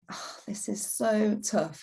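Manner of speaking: slow, whispered "this is so tough"; slow whispered despair. Retold or imitated. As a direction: sigh it out, worn down. Acted inner voice despairing.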